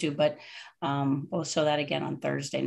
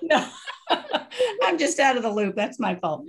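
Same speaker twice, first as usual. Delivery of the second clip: laughing; 8 semitones higher than usual. Real, not acted. Host laughing apology.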